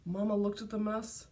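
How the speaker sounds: ordinary delivery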